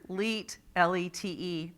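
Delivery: "leet" said alone then spelled L E T E, slow; slow "leet", then the letters L, E, T, E; sounding out a syllable. Shown as a demonstration. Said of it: "read the word syllable by syllable".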